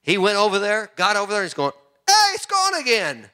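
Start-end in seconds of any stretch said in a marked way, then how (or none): 2.1-3.3 high mock cry of disbelief; storyteller's high, startled villager; surprised cry. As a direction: call out in high-pitched amazement, acting it. Villager retold calling out in surprise.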